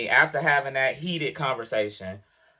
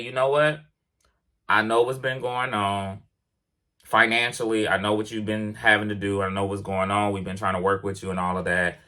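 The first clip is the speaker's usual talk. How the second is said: lower, measured boss lecturing a driver; mock boss voice; 7 semitones lower than usual. Driver imitating the boss giving a verbal warning, lower mock voice. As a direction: play the manager delivering a verbal warning, lower and dismissive. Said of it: "had he said".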